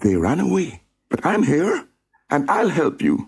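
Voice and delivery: reassuring friend. Acted. Sam reassuring trapped Rory that he'll help. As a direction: comfort him bravely.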